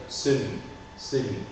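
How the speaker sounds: celui as "se-lui", two syllables; mute E pronounced. Shown as a demonstration. Teacher models the demonstrative for student, celui with E sounded. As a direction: sound the E of celui, never "s'lui". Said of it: "On en prononce le E muet".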